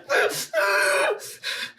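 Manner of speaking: high breathy scream of pain; mock pained scream. Brother pours alcohol on his cut, mock pained yelp. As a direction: let out a retold yelp of agony.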